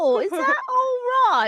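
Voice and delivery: high-pitched put-on British accent; hammed-up British questioning; mock British accent. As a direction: tease in an exaggerated British voice. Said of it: "My kink is British accents".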